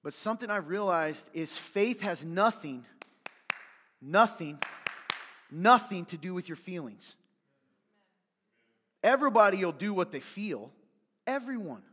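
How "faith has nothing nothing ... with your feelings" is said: "nothing" hammered louder each time; stressed nothing, repeated. Real, not acted. Preacher drives home that faith isn't feelings, stressing "nothing". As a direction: punch each "nothing" and land it hard.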